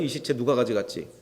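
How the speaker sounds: a hushed, broken-hearted lament; quiet tearful murmur. Retold or imitated. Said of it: "하면서 지금 울고 있는 거예요".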